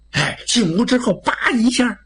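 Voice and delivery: plain reading voice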